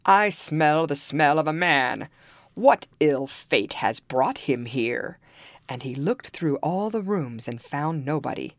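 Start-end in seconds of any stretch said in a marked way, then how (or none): 0.0-5.2 loud, slow ogre growl; big suspicious giant. Acted. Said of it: "cried he".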